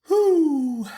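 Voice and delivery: a high, loud whew of relief; relieved whew. Real, not acted. After the political rant, a loud high whew of relief. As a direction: blow out a big relieved whew.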